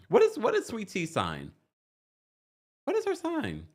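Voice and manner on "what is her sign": much higher curious ask; eager question. Real, not acted. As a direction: pitch it up keenly.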